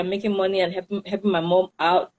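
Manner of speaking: usual speaking voice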